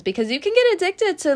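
sudden animated leap up. Real, not acted. Tarot reader telling viewers, high and excited. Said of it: "Why in the world am I talking like this?".

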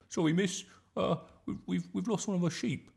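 put-on high-pitched child's voice; apologetic schoolboy. A narrator's reading. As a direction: pupil owning up to teacher in a high voice. Schoolboy reporting a lost sheep.

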